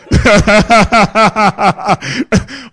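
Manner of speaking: a long, loud real laugh. Real, not acted. Preacher laughing as wife refuses supper, long laugh. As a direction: laugh it out at the memory.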